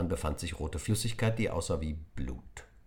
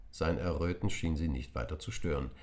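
ordinary delivery

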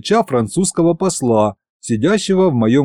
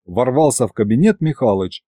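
ordinary delivery

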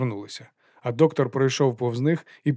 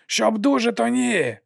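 high shouted answer; shouted reply; 10 semitones higher, slower than usual. A narrator's reading. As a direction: yell back cheerfully. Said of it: "вигукнув у відповідь Лудзе".

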